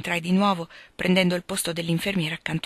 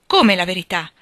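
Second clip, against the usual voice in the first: loud, startled question; sudden exclamation, hit hard on the first word; shocked exclamation; 7 dB louder than usual. A narrator's reading. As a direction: burst out in disbelief. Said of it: "esclamò tirandosi a sedere".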